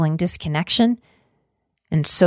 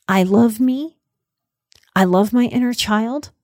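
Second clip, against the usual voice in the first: spoken affirmations; 5 semitones higher than usual. Acted. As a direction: say each affirmation as a gentle self-promise. Affirmation reframe.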